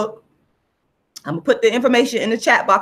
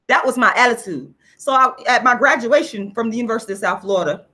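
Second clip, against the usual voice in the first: normal talking voice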